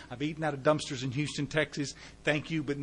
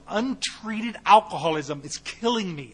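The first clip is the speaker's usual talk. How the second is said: ordinary talk, nothing marked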